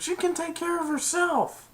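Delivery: squeaky-high quote at normal volume; high put-on voice. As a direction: imitate an onlooker way up high. Retold onlookers' remark.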